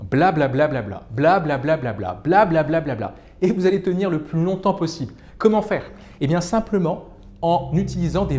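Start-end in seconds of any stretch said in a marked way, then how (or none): 0.1-3.1 varied blabla. Shown as a demonstration. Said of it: "uniquement la syllabe bla pour vous exprimer".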